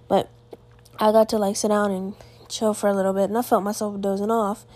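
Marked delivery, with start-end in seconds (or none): none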